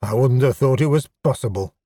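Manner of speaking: usual speaking voice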